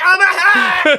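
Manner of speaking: squeaky high voice. Retold or imitated. Acting out the jumper.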